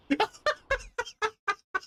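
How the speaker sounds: high-pitched giggle; high laugh. Real, not acted. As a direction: laugh off the scare. Laugh after a jump scare.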